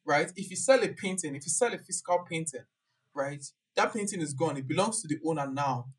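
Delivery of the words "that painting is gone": ordinary talk, nothing marked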